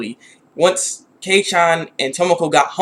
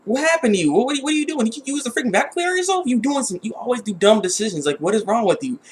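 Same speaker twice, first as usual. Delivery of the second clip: high-pitched mock scolding mother, gets quieter; scolding mom; 6 semitones higher, 4 dB quieter than usual. Mocking a mom who scolds her daughter over the vacuum, high-pitched scolding. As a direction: nag like an exasperated mom. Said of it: "she's like".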